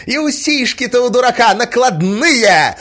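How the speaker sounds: high, loud, stretched-out screech; spiteful clincher. A narrator's reading. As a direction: cap the insults with a shout. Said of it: "скрипила Марья Александровна". (злобно, громко добить)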